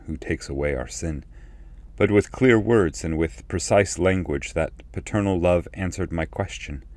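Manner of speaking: ordinary delivery, nothing marked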